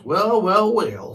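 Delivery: mocking well, well, with a jeer; sneering. A narrator's reading. Snotlot taunting poo-covered Hiccup, sneering. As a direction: lean into the sneer. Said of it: "sneered Snotlot".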